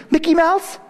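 put-on high, innocent child's "Mickey Mouse?"; little girl's guess. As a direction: voice a three-year-old guessing. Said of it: "She goes".

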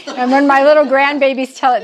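ordinary talk, nothing marked

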